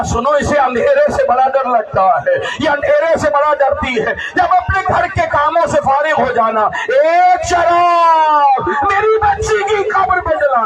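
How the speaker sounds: mother's mourning plea; keening lament. Acted. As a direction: beg them to light her lamp. Mother's plea, lamp on grave.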